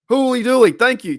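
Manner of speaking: loud, high delighted outburst; excited exclamation. Real, not acted. Host thanking Felicia for donation, loud delight. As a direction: burst out at the surprise gift. Reacting to a ten-dollar donation.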